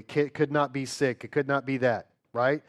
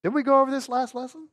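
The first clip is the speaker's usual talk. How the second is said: teasing chide; 10 semitones higher, faster than usual. Real, not acted. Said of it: "teacher chiding class".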